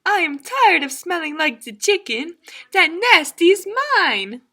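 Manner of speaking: high, loud duck exclamation; exasperated French duck. Acted. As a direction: huff it out like an offended duck. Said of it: "said the duck".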